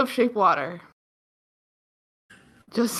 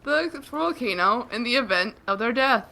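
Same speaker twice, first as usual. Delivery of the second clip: ordinary talk, nothing marked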